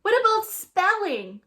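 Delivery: high, loud schoolboy shout. A narrator's reading. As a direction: call out from the back. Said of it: "shouts Randy Potts".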